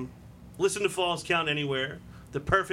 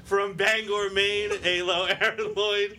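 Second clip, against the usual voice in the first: higher, ringing wrestling introduction; ring announcer; 6 semitones higher, 4 dB louder, slower than usual. Acted. Host presents co-host from Maine, ring announcer style. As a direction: announce the name to the whole arena.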